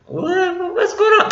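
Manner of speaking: high startled bewilderment; confused. Retold or imitated. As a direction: play it like a baffled guy caught off guard. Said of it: "He's like".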